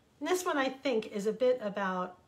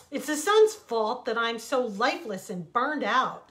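loud, aggrieved protest; crying out; 3 semitones higher, 9 dB louder than usual. A narrator's reading. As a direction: blame the sun out loud. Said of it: "the sand cried".

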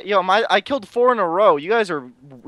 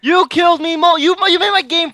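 high, loud, indignant challenge; worked-up gamer squaring up for a playful fight; mock-angry confrontation; 11 semitones higher, 4 dB louder than usual. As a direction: pick a fight with a teammate, pitched way up. Quarrel over being killed in game.

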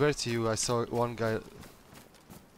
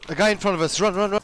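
panicked shouting, much higher; yelling while running away; 11 semitones higher, 12 dB louder, faster than usual. Real, not acted. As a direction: yell in a panic while fleeing. Panicked escape from a stranger.

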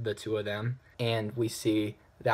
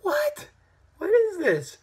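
squeaky, much higher disbelief; baffled; 6 dB louder, slower than usual. Retold or imitated. Reviewer recalling his reaction to the Joker, high incredulous squeak. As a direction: shoot up into a stunned squeak. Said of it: "And I was just like".

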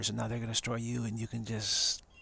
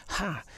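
short high mock cackle; a quick hammed-up witch laugh; 8 semitones higher than usual. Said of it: "cackling, say".

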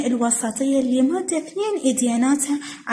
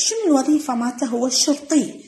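usual speaking voice

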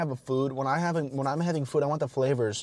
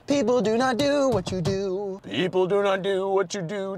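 silly put-on voice; 4 semitones higher than usual. Talking to himself, goofy sing-song voice. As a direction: play with the phrase in a goofy voice. Chanting the phrase in odd voices.

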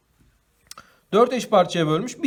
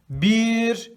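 each word of "bir bölü iki" drawn out slowly and loud; slow reading demo; 3 semitones higher, 5 dB louder, much slower than usual. Shown as a demonstration. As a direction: read "bir bölü iki veyahut da ikide bir" slowly and loudly for the kids. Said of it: "diye okuyoruz".

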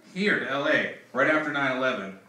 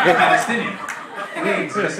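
emphatic insistence; 3 semitones higher, 7 dB louder than usual. Retold or imitated. Insisting to the man.

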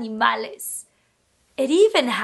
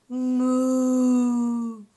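long, low steady moo; cow moo; 4 semitones lower than usual. Shown as a demonstration. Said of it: "Can you moo like a cow?".

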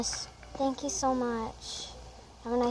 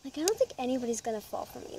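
rushed; much faster than usual. Real, not acted. Quick nervous remark.

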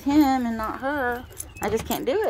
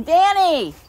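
loud, high shout of the dog's name; call out; 7 semitones higher, 8 dB louder than usual. Real, not acted. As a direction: call her in. Calling dog.

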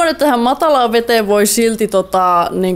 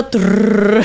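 rolling trilled r like a trotting pig; playful trilled sound effect; rolled trr noise. Pretend.

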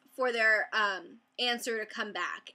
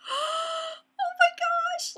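very high, very slow squeak; overwhelmed squeal; 4 dB louder, much slower than usual. Retold or imitated. Vlogger reliving approval after a gasp, overwhelmed squeal. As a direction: squeal it out in tiny stretched syllables.

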